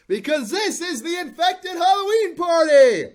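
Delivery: high, loud party-host cheer; excited shout. Acted. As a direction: hype the crowd up. Host hypes the party opening.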